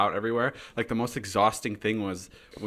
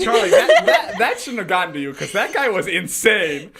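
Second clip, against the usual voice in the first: much higher and louder, laughing through the words; giggling protest; 10 semitones higher, 9 dB louder than usual. Real, not acted. Host laughs as she tells Charlie not to mind him, high and loud.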